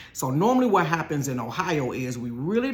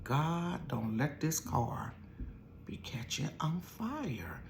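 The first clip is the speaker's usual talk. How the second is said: silent prayer; 10 dB quieter, much slower than usual. Retold or imitated. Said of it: "thinking in my mind".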